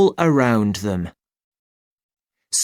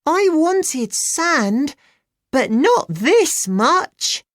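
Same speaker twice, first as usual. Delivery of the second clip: put-on high, slow girl's voice with a smile; amused girl. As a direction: say it wryly as a little girl. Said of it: "Rosie smiled".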